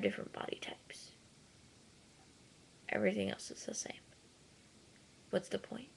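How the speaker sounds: fast, soft whisper. Real, not acted. Vlogger whispering to viewers that animals are the same. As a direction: drop to a whisper as if sharing a secret.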